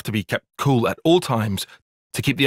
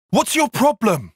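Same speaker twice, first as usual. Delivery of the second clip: indignant challenge; 11 semitones higher than usual. Acted. Confronting someone in an argument.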